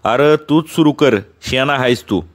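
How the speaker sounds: usual speaking voice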